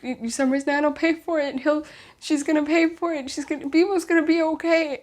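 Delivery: high, tearful words tumbling out in relief; hysterical relief. Retold or imitated. To dad on the phone, telling him the nan will pay, hysterical relief. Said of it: "I was just hysterical".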